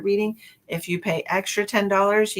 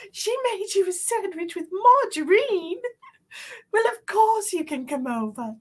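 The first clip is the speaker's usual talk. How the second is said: high mock-British phone voice; hammed-up British lady, incredulous then gracious; 10 semitones higher than usual. Host re-enacting the complaint on the phone, exaggerated British accent. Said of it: "And they're like".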